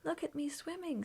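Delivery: high, proud little squeak; squeaky little Roo. A narrator's reading. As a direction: pipe it up like a small excited joey. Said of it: "squeaked Roo".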